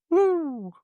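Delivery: high, drawn-out "woo"; excited whoop. Real, not acted. Host welcomes the guest onto the show, excited whoop. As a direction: give a high cheering whoop.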